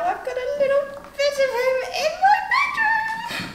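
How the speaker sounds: high, rising giddy squeal; giddy crush. Acted. Girl confiding about the boy she fancies, giddy. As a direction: gush with a laugh, voice climbing high.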